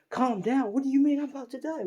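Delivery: high, loud, incredulous outburst; alarmed protest. Acted. Character answers being told to calm down, alarmed protest.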